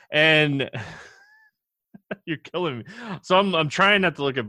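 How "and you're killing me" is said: laughing. Real, not acted. Co-host clowns during the ad.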